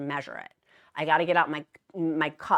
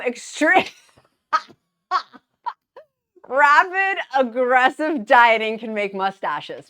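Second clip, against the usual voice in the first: louder, slow words through laughter; laughing; 5 semitones higher, 10 dB louder, slower than usual. Real, not acted. Explaining dieting to viewers while laughing loudly.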